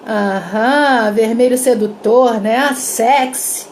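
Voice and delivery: slow, drawn-out seductive teasing; suggestive. Shown as a demonstration. Someone teasing a friend's red party outfit, suggestive. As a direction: linger on the words, loading them with innuendo. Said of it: "tem uma entonação que faz com que".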